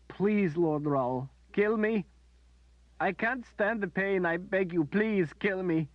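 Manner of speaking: high, loud, slow tearful pleas; high slow anguished pleading; agonized begging. A narrator's reading. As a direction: beg for death through sobs. Berdine begging in agony.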